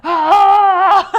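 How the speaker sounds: loud high yelp, mimicking the boy; sudden shrill-free yelp at full volume; kid's startled yell. Wife imitating the son's startled yelp. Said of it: "he was like".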